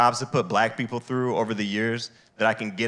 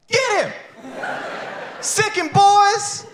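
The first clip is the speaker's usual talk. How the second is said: much higher, louder, slow hammed-up cheer; gleeful cheering; 4 dB louder, slower than usual. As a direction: egg the cops on with glee.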